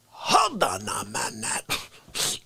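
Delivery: slow, low mob boss opener; mobster voice. Acted. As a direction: drag it out like a don. Mobster-style comic monologue opening.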